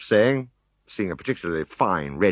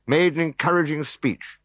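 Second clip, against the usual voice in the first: ordinary delivery, nothing marked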